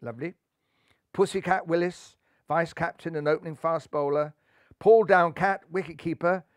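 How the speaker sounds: usual speaking voice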